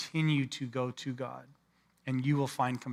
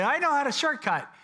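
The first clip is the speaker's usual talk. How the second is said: sly eager congregant; 11 semitones higher, 4 dB louder than usual. Retold or imitated. Congregation voiced chasing retreat.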